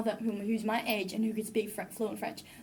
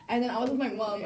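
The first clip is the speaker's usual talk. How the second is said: usual speaking voice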